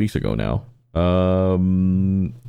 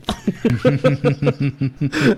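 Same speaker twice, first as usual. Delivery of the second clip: laugh; 8 semitones higher than usual. Real, not acted. Laughing at the story.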